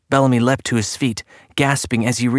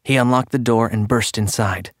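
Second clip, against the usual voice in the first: usual speaking voice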